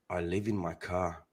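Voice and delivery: low, slow and hushed; quiet confession. Acted. Prankster confessing to an electricity telemarketer, quietly. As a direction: say it softly and slowly, way down low. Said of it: "Like that".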